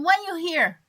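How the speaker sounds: normal talking voice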